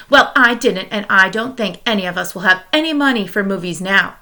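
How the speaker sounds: ordinary delivery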